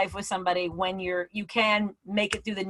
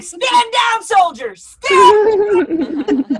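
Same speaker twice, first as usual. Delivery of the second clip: high, loud battlefield command; hammed-up military shout; yelling; 10 semitones higher, 10 dB louder, much slower than usual. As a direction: yell orders at troops in a sleepwalking panic. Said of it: "I was yelling at him going".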